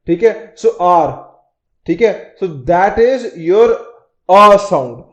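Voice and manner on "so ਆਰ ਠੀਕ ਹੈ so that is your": ordinary talk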